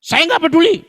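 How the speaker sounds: mock furious driver threatening; angry retort. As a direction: shout it like an arrogant rich man. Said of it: "dia bilang".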